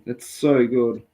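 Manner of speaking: ordinary talk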